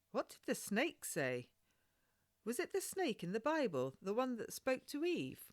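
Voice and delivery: put-on high, quick curious child voice; a little girl hungry for the story; young girl pressing her questions; eager young girl. Child Maggie asks about the snake.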